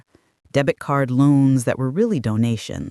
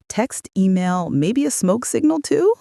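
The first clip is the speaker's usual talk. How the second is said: lightly tease, lifting the end high; mild deadpan-free joke with a lilting finish; joking; 7 semitones higher than usual. A narrator's reading. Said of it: "I joked".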